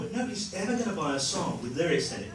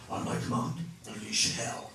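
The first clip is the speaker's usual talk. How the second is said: put-on Australian accent; 6 semitones lower, 5 dB quieter than usual. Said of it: "And he's like this".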